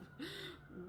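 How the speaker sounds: a high, soft laugh that cracks into a sob; high crying laugh. Real, not acted. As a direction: let the laugh break toward tears. Laugh breaking mid-plea.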